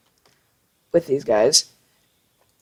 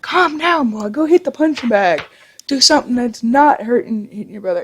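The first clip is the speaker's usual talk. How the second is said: high-pitched imitation of an exasperated mother; hammed-up, high motherly scolding; scolding mom; 6 semitones higher than usual. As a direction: put on Mom's voice breaking up a fight. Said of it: "My mom came out and was like".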